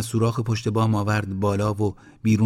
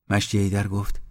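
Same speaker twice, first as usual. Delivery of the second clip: plain reading voice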